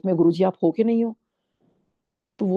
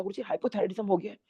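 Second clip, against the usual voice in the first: normal talking voice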